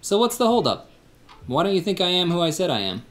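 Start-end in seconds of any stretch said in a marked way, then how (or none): none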